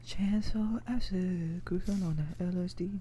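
whispered rap line; whispering. Pretend. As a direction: breathe it out like a secret.

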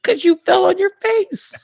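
very high, loud retort; mock exasperated shout. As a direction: squeal the comeback in mock outrage. Retold argument, coach shouts back.

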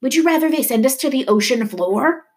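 high, heated retort; angry yell. A narrator's reading. Leto arguing back at Poppy, yelling. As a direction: throw the question back in a yell. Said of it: "Leto yelled".

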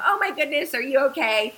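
high-pitched, mock-panicked check on someone; frantic retold cry. Mother hugging her rescued daughter, retold frantic and high. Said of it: "I'm screaming, I'm crying".

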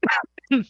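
coughing fit after choking; real cough, choking on a drink. Real, not acted. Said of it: "Perdón, me ahogué".

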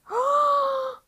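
high sharp gasp; loud high gasp; excited gasp. Real, not acted. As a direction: gasp with delight. Sudden excited idea.

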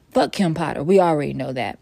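ordinary talk, nothing marked

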